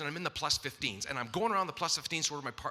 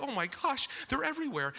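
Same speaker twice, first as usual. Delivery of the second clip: panicked exclaim; 9 semitones higher than usual. Retold or imitated. Magazines spilled on sidewalk.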